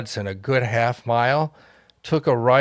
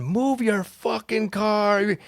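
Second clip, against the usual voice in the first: mock yell; 7 semitones higher, slower than usual. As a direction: imitate a driver yelling from down the street. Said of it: "people are yelling".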